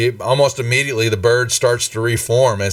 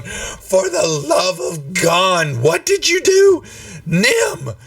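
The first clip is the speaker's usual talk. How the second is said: high-pitched exasperated outburst; steaming mad; 8 semitones higher, slower than usual. Acted. Valletta blows up at Nim like a cartoon, steaming mad. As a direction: stretch it out, climbing to an outraged squeal. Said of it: "cartoon where steam starts coming out of the ears".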